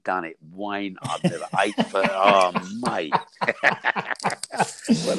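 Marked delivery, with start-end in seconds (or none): 3.2-5.0 a high, wheezing burst of laughter; hearty laugh. Real, not acted. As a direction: crack up at your own blunder. Laughs at his own mistake.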